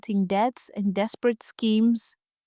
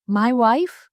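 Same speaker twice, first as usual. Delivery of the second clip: louder, incredulous question with a rising end; narrated husband's baffled query; bewildered, dismissive; 2 semitones higher, 5 dB louder than usual. A narrator's reading. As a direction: toss it back in puzzled disbelief. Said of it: "Eon, bewildered, waved dismissively".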